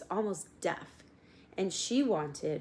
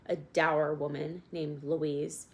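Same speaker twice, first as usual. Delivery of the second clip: ordinary delivery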